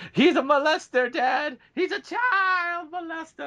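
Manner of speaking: high, loud quoted yell; movie line shout. Retold or imitated. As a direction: yell it like the film scene. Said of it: "there's a line in Freddy Got Fingered".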